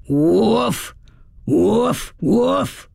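hammed-up woof woof woof; imitating a guard dog. A narrator's reading. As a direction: bark like a big guard dog. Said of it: "as loud and low-pitched as I could make it".

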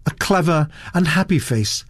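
plain reading voice

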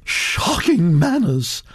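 lofty, drawn-out condemnation; disapproving Duchess. Acted. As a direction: pronounce it slowly with disapproval.

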